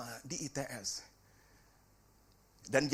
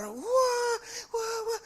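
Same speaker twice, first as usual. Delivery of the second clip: excited wah; 4 dB louder, much slower than usual. Real, not acted. Marvelling at son's progress.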